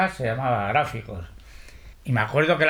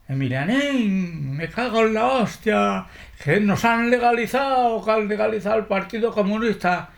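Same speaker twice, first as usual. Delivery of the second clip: high, thrilled shout of joy; excited news; 8 semitones higher than usual. Retold or imitated. Comrade waking him with news of the legalisation, thrilled. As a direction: burst out with the news.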